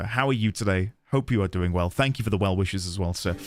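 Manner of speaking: ordinary talk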